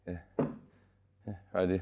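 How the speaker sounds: ordinary delivery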